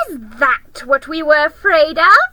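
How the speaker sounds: high, loud scornful creature; mock him with loud scorn; jeering creature. Another creature mocking Aslan, high and loud. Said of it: "said another".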